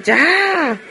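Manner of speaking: high, drawn-out mock cry of enough; exasperated cry. Aunt fed up with the family racket, high exasperated cry.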